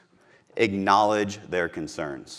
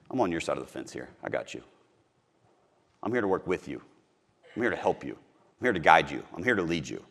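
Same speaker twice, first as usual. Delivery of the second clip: softer, lower, calm promise; reassuring; 4 semitones lower, 5 dB quieter than usual. Retold or imitated. Salesperson telling a wary prospect he's on their side, soft and reassuring. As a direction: gently win the neighbor over.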